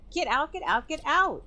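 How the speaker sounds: very high, rising shout; shrieking. Acted. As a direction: chase them off screaming. Clarissa repeating shout.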